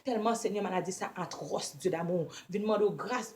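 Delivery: ordinary talk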